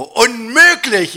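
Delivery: high, loud, drawn-out disbelief; indignant outburst. Acted. Dieter rejects the scale's verdict, indignant outburst. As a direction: protest loudly and slowly.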